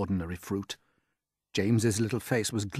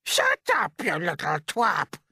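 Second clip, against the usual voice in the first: a far higher, louder aunt's shout; Aunt Spiker shouting; 9 semitones higher, 5 dB louder, slower than usual. Acted. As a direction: screech it out as the nasty aunt. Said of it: "Aunt Spiker snapped".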